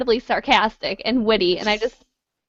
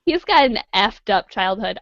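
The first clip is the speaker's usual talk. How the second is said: normal talking voice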